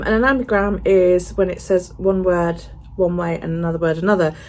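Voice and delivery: ordinary talk, nothing marked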